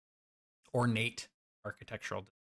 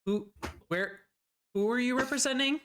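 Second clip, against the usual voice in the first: higher, rushed, puzzled official; flustered governor; 9 semitones higher than usual. Acted. Governor confronted by strangers, flustered and high. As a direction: sputter like a caught-off-guard politician.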